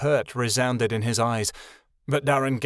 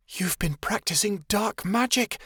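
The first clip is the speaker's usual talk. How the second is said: higher, slow, amazed; girl amazed at Darren's fire, breathless; awed gasp; 9 semitones higher than usual. A narrator's reading. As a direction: marvel at his dark magic. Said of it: "Chameleon gasped".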